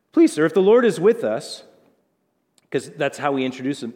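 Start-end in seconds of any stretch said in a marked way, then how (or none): 0.1-1.6 high, loud, panicky pleading; voice cracking higher. Retold or imitated. Frightened Gideon pleading with the angel, voice jumping up. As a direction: beg like a terrified young man. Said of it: "I bet his voice hit another octave".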